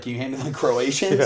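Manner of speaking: laughing mock request. Joking example line.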